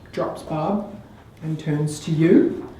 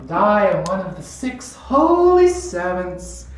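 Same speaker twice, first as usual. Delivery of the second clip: gloating Countess; 3 semitones higher than usual. Acted. The Countess reveals herself as the Assassin, grandly menacing. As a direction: savor the reveal, slowing into the challenge. Game master plays villain's reveal.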